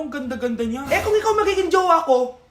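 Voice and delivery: ordinary talk, nothing marked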